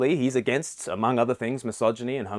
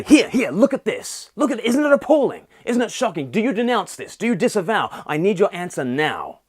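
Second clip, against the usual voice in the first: much higher and louder, shrill performative shock; mock outrage; 8 semitones higher, 5 dB louder than usual. Social media users demanding you denounce, mock outraged. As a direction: ham up a pearl-clutching demand for denunciation. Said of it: "We say".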